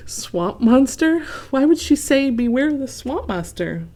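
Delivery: high, worried questioning; a touch of nervous alarm. A narrator's reading. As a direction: Goose nervously repeating the warning. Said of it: "Goose gulped".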